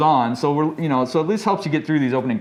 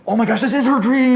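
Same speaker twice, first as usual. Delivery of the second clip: excited; 9 semitones higher, 4 dB louder than usual. Retold or imitated. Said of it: "I'm just like".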